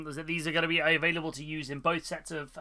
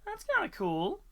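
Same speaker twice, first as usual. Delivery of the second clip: much higher, mock-impressed; high silly voice. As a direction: squeak it out, impressed. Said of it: "I was like".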